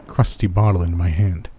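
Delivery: ordinary delivery, nothing marked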